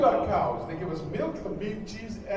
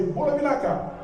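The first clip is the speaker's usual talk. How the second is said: shouted greeting; 7 semitones higher than usual. Shown as a demonstration. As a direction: call it out high and strong. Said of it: "shout the great word".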